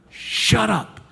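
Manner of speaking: high-pitched, exasperated hushing; mock scolding. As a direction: act out a crowd silencing someone. Mob scolding the blind man.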